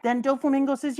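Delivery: ordinary delivery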